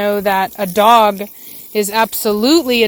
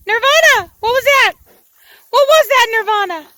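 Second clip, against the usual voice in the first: high pet voice; 13 semitones higher, 4 dB louder than usual. Real, not acted. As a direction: coo at your dog from across the yard. Calling dog in pet voice.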